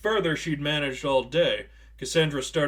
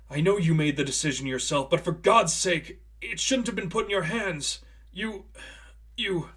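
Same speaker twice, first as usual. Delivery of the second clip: higher, rising distress; pained plea; 5 semitones higher than usual. A narrator's reading. Priest pleading with Cassandra at her bedside, rising distress. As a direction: plead as it breaks down.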